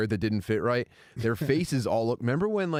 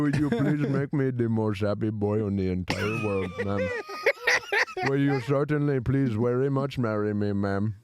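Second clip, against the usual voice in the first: put-on earnest Indian accent proposal; overly formal Indian accent. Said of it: "Tim, you proposed to me".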